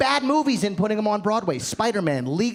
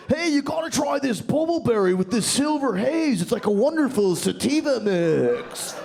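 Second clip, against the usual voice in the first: put-on stoner kid named Dorito; 3 semitones higher than usual. Kid pitches his pot mix over coffee, stoner kid. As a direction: voice a laid-back kid pushing weed. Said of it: "some kid named Dorito walks up".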